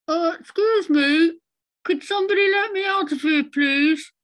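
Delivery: high, loud muffled plea from inside; muffled little voice. Retold or imitated. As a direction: call out, trapped and muffled, very high. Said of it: "little voice, muffled voice going".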